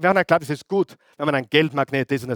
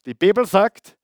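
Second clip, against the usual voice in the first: ordinary talk, nothing marked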